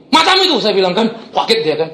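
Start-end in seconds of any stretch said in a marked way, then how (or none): 0.1-0.6 loud, high burst of rebuke; retold angry bark. Pastor rebuking the thug's denial, retold angry bark. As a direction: throw it back at him, raised and sharp. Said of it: "Langsung saya bentak".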